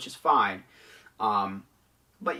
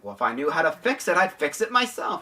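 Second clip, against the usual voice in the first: higher, louder retold comeback; mock exasperated retort; 7 semitones higher, 7 dB louder than usual. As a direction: imitate an indignant worker, pitch climbing. Retells electrical-room actor's exasperated retort.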